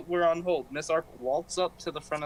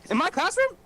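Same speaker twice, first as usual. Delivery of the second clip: much higher, louder shriek of disbelief; shocked teacher; 5 dB louder than usual. Retold or imitated. Teacher shrieks at the student who phoned the superintendent. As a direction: squeal it like an outraged teacher. Teacher learns of call in class.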